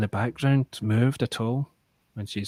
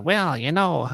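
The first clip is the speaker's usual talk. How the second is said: high, louder "Well, you know" with a falling drawl; Norm Macdonald impression; 6 semitones higher, 4 dB louder, slower than usual. Explaining the comedian's voice, a light Norm Macdonald impression. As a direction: mimic the Canadian comedian's lazy "Well". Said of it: "he talks like".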